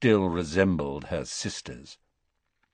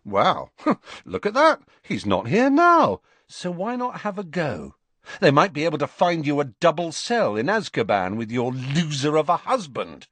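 mocking; 7 semitones higher, 3 dB louder than usual. A narrator's reading. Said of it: "looked mockingly".

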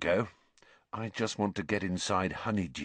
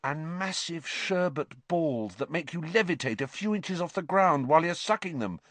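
high-pitched absorbed relish; oblivious enthusiasm; rapt; 9 semitones higher than usual. A narrator's reading. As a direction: carry on the list, lost in delight. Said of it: "plainly not listening to a word Hermione was saying".

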